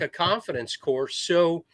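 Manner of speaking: usual speaking voice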